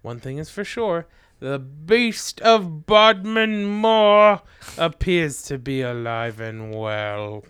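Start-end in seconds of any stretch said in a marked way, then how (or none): 1.4-7.4 slow ominous narrator voice; dramatic slow. Acted. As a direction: draw it out like a trailer. Dramatic documentary ending.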